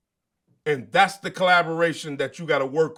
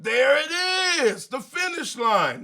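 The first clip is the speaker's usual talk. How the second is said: high, stretched cry on sighting the finish; acted runner's excitement; 9 semitones higher, 4 dB louder than usual. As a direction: exclaim it like a runner seeing the end. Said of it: "You like".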